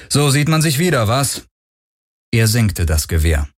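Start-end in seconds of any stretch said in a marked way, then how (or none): 0.1-1.5 higher-pitched, uncordial greeting; little cordiality. A narrator's reading. Galen meeting an old acquaintance, without cordiality. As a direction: greet him without much warmth. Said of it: "ohne große Herzlichkeit".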